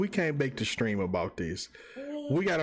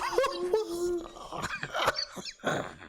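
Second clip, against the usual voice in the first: long high laughing fit; real wheezy laughter; helpless laugh. Real, not acted. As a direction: laugh till the breath is gone. Cracking up at memes.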